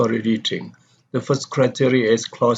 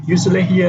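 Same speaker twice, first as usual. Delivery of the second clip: "Usually here" higher, stressed at the start; emphasized adjunct; 7 semitones higher, 5 dB louder, faster than usual. Shown as a demonstration. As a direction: hit "Usually here" with raised pitch. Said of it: "usually here is emphasized".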